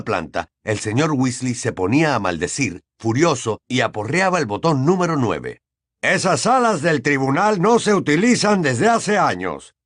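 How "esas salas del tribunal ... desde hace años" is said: annoyed. Acted. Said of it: "con enojo".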